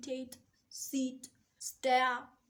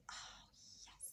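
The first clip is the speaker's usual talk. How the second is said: breathy whispered oh yes of relief; mother hearing husband will watch their son, whispering relief; relieved whisper; 22 dB quieter than usual. Retold or imitated. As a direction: whisper it like a tired mom's prayer answered. Relieved answer to husband's offer.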